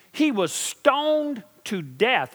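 each word drawn out and high; slow, weighty. Acted. Preacher telling the church about Paul, slowly and gravely. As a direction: lean hard on stoned to death. Preacher dwells on Paul's suffering.

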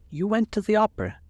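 appalled echo, falling at the end; pained reproach. A narrator's reading. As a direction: lift the pitch in shocked reproach. Repeats the question in pained reproach.

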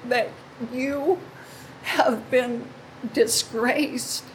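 pleading apology on the edge of tears; tearful apology. Real, not acted. Woman apologizing to border families through tears.

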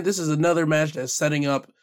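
ordinary delivery

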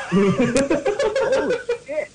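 plain reading voice

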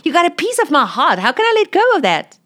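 pleading swell of passion; high, fast rising plea; impassioned. Retold or imitated. Impassioned God's voice.

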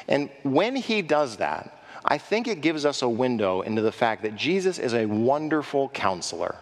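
ordinary delivery

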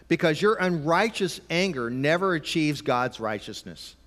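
slow, weighty scripture reading. Shown as a demonstration. Preacher reading James aloud slowly to parents. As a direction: read it as holy words, unhurried. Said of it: "James chapter one".

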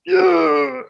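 high, thrilled yeah; a sudden burst of glee; excited. Real, not acted. Hears the X-Men theme is in.

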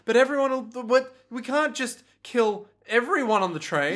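high-pitched hesitant protest; mock worry. Pesci objects to killing the passengers, mock worry. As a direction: stumble through an anxious objection.

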